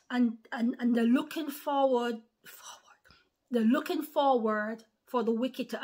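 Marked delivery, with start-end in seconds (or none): 2.5-3.0 drops to a hushed whisper mid-sentence; sudden confiding whisper. Real, not acted. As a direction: lean in and whisper it like a secret. Repeats phrase, then whispers it.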